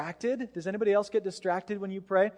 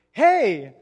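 mock loud hail; 3 semitones higher, 10 dB louder than usual. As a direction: imitate a pushy seatmate calling out. Chatty seatmate calling to pastor.

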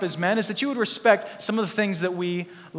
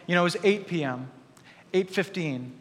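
ordinary delivery

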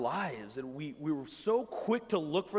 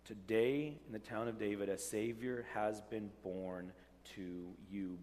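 soft reverent reading; 6 semitones lower, 8 dB quieter than usual. Real, not acted. Angel quote repeated softly.

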